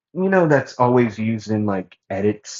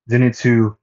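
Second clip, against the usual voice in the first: usual speaking voice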